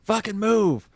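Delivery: impatient driver yelling at the truck ahead; mock angry yell. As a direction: bark it like a driver stuck in traffic. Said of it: "the guy in the back's like".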